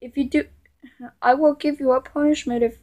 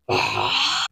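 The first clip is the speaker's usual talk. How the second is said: long voiceless exhale; heavy sigh; 4 dB quieter than usual. Acted. Tired of someone not stopping, heavy sigh. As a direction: let out a long, worn sigh.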